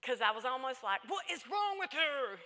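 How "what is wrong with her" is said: exasperated retold outburst, higher and louder. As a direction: slowly demand it, nearly losing your temper. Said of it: "I almost got mad at her".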